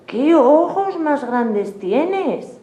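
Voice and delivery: put-on high, slow, amazed girl's voice; wondering little girl. A narrator's reading. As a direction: stretch it out in a high, wide-eyed voice. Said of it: "Caperucita dice". (niña asombrada, aguda y lenta)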